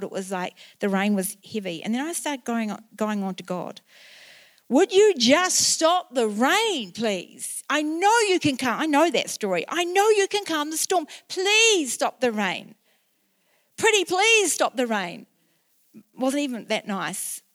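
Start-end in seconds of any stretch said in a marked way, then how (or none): 4.7-8.8 high, loud, slow desperate pleading to God; hysterical pleading. Retold or imitated. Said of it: "I was actually getting quite hysterical". 9.7-15.3 hysterical pleading. Retold or imitated. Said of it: "I was actually getting quite hysterical".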